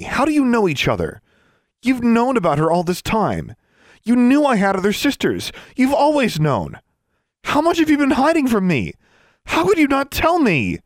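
loud, high-pitched accusations; outraged confrontation. A narrator's reading. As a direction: build into a betrayed outburst. Daughter confronts mother over secrets.

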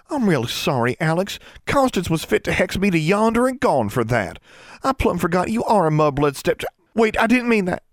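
put-on high, breathless girl's apology, rushing; a young country girl tripping over her words; high flustered girl voice. A narrator's reading.

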